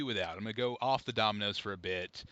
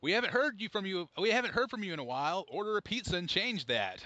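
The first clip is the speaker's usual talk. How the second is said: higher, quicker mock email headline; imitating a blunt marketing email; unsubtle sales pitch; 8 semitones higher than usual. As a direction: read the pushy headline in a raised, exaggerated pitch. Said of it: "lack of subtlety at all. It just said".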